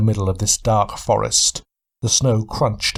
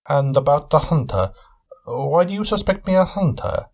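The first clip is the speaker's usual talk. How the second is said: curious; 6 semitones higher than usual. Acted. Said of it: "curious tone to his voice".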